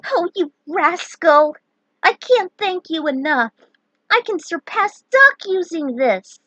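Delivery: high, loud and thrilled; gushing delight. Acted. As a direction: squeal with grateful joy. Prish gushing, hugging him.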